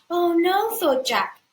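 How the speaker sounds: put-on high, anxious boy's panic; scared boy. Jack panicking about being eaten, high and scared. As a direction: fret it like a frightened kid.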